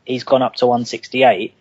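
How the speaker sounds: ordinary talk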